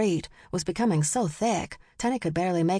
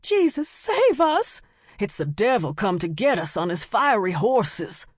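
beseech the heavens in terror; screamed plea; 3 semitones higher than usual. A narrator's reading. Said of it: "Women screamed".

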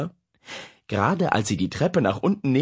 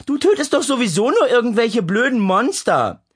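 high, loud teasing yell; bellowed mockery; 12 semitones higher, 6 dB louder than usual. Sister yells at her brother from next door, mocking. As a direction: shout through the wall, mocking. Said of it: "brüllte Lea".

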